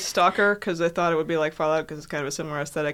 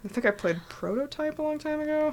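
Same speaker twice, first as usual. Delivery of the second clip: hushed whisper; 6 semitones higher, 7 dB quieter than usual. Real, not acted. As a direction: talk under your breath. Whispered exchange.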